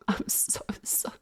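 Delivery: hushed, voiceless wheeze of laughter; laughing apology. Real, not acted. Streamer apologising for waking the roommates, real breathless laughing. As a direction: squeeze the words out through quiet laughter.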